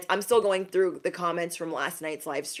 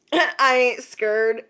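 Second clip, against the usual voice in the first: laughing bravado; 5 semitones higher, 7 dB louder, much slower than usual. Acted. Laughing denial of eclipse fear.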